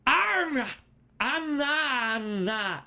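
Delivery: high, loud wordless mock war cry; clumsy roar meant to scare a zombie; botched intimidation roar. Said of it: "attempts his absolute best at an intimidation check".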